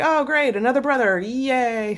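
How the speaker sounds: mock-cheerful, high sarcasm trailing off; sarcastic. Artemis hearing of a new brother, lightly voiced sarcasm. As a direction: fake enthusiasm over unwelcome news. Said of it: "she's like".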